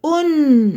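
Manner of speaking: "أن" as short u with a long held n; tanween damma sound. Shown as a demonstration. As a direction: draw out "أن" as unnn. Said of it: "دي تنوين بالضم". (صوت تنوين الضم، "أن" بتتنطق أُنّ بنون ممدودة)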